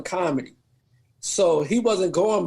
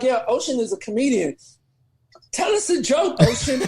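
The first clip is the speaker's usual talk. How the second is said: pushy friend; 6 semitones higher than usual. Retold or imitated. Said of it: "She's like".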